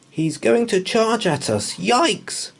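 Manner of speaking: put-on high, panicky boy voice; scared. Boy warns his dog of the charging mammoth, scared. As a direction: yelp it in fright.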